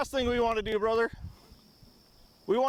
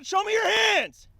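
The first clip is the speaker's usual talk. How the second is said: shouted surrender demands; 7 semitones higher than usual. Real, not acted. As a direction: shout the order repeatedly. Commanding the downed suspect.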